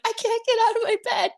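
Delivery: high squeaky whine of panic; panicked. Retold or imitated. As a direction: re-enact being frozen with fear. Retelling frozen panic to showrunner.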